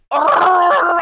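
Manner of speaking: loud growling creature roar; Chewbacca. Acted. Trying the voice though the throat hurts, loud roar. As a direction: attempt a rolling wookiee growl from the throat. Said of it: "Chewbacca voice".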